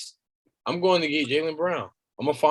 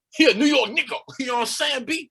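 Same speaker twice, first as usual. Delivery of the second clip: put-on teasing New York accent, pitched up; mock New York accent; 8 semitones higher than usual. Teasing a friend about his Knicks player, mock New York accent. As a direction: imitate a New York homeboy. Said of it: "And then he said it in their accent".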